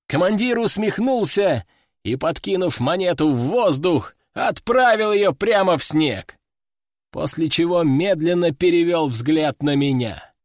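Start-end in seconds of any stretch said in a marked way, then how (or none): none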